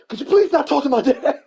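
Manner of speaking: put-on high, loud, laughing teen snap; bratty daughter. Camille telling neighbor kids off, high loud snap. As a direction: play the irritated teenager, loud and high.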